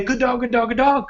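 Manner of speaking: high, gushing repeated praise; "Good dog, good dog" said high and gushing, over and over; excited praise. Shown as a demonstration. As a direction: gush over the dog the wrong way. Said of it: "I'm not gonna say".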